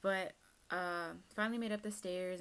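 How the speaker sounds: ordinary delivery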